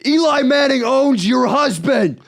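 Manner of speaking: loud, high, slow taunting yell; obnoxious drunk fan hollering; drunk New York heckler. Retold or imitated. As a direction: crow it at the rival's wife. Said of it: "drunk New York asshole was talking shit".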